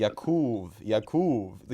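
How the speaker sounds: "Yakub" with stress and a long "oo" on the second syllable; demonstrated mispronunciation. Retold or imitated. Correcting his friend on what he was called, "Yakub" with a long "oo". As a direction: repeat "Yakub" as the schoolmate said it, long "oo". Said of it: "what the heck he was calling you".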